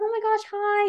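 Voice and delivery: high-pitched retold hello; excited greeting. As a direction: greet fans with delighted surprise. Imitates star's warm greeting.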